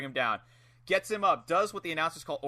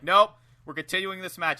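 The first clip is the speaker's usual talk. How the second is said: mock referee; 3 semitones higher, 7 dB louder than usual. Voicing the referee refusing count.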